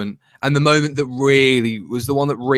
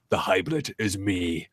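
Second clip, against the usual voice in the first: low, slow, soft portentous quote; dramatic reveal; 6 semitones lower, 8 dB quieter, slower than usual. Retold or imitated. Quoting the Doctor's reveal, gravely dramatic. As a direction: intone it like a grave revelation.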